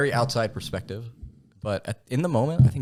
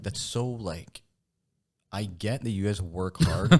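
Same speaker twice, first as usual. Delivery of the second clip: slow sarcastic drawl; slower than usual. Retold or imitated. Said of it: "he's just like".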